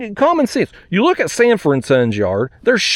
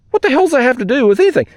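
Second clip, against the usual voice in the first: higher, faster exasperated repeat of the question; 7 semitones higher, much faster than usual. Shown as a demonstration. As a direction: fire the question again, more worked up. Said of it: "look, what the hell".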